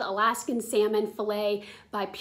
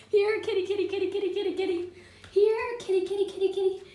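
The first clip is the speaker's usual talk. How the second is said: high sing-song here kitty call; calling a cat; 8 semitones higher, faster than usual. Real, not acted. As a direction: call the cats in, high and bright. Calling cats again.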